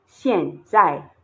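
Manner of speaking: 现在 slow and loud, each syllable falling; two falling tones. Shown as a demonstration. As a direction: drop firmly on both syllables of 现在. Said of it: "two falling tones".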